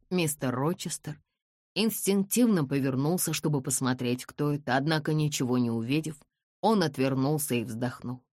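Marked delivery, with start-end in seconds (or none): none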